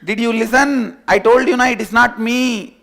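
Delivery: high, loud, laughing call. Acted. Sheep shouts back from a distance, amused.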